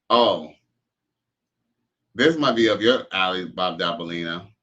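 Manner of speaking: usual speaking voice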